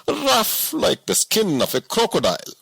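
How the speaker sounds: a simple woodsman who can't believe it; woodcutter voice. A narrator's reading. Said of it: "said Somana".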